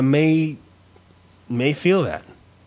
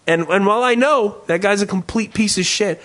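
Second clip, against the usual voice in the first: normal talking voice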